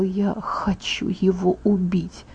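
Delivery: slow, hushed, deadly whisper; menacing whisper. Acted. Edward confesses his rage to Bella in a slow threatening whisper. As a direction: breathe the threat out slowly, almost silent.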